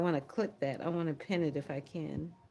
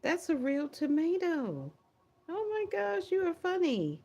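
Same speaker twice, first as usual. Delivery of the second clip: high, excited gushing; high-pitched giggly excitement; delighted; 8 semitones higher than usual. Real, not acted. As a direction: squeal with delight at a funny comment. Delighted by a viewer's tomato picture.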